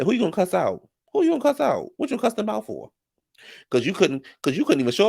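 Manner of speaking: high-pitched, incredulous challenge; mock confrontation. Recapper calls out the absent husband over his threats, incredulous challenge.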